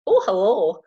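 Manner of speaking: loud, high comic German greeting; high, loud mock German voice; attempted German accent. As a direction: copy a German-accented hello, hammed up. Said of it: "attempt a German accent here".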